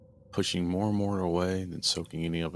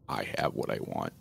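much lower, menacing demon growl; 10 semitones lower, 3 dB quieter than usual. Acted. Shadow demon answering the mother, low growl. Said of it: "he growled".

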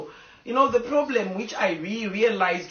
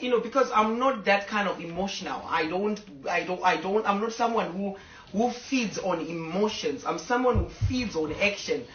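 usual speaking voice

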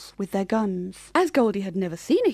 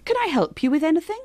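eager helper; 7 semitones higher, 3 dB louder, faster than usual. Acted. Clover offers help.

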